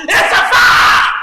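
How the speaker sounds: loud, high drawn-out holler; full-force shouted alarm. Acted. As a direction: yell fire like raising the alarm in a packed room. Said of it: "just holler".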